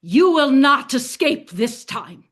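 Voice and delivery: slow sneering taunt from a guard; mocking guard taunt. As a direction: gloat like a laughing guard. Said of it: "And they're laughing".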